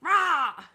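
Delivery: loud exasperated growl; frustrated roar. Real, not acted. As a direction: let out a roar of annoyance. Frustrated outburst.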